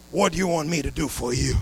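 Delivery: low, soft, tender asking; a gentle Jesus speaking to the blind man; gentle question. Acted.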